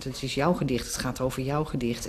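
imitating colleagues' low, soft prompting; hushed aside. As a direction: quietly quote the others tipping her off. Poets around her hinting on stage. (zachtjes nagedaan, als terzijde)

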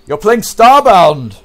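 high, loud, slow announcement; excited outburst. Real, not acted. Player announcing Starbound to viewers, excited shout. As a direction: proclaim it with drawn-out excitement. Blurts out a game title.

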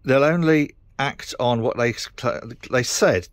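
normal talking voice

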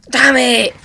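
very loud, high curse; high, full-volume outburst of annoyance; real angry yell. Real, not acted. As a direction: yell it in sheer frustration. Fails the jump again.

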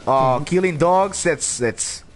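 usual speaking voice

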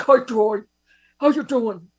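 lightly voiced Bernie-like greeting; a hint of Bernie's voice; imitating Levy. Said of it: "He'll be like".

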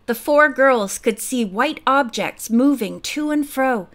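ordinary delivery

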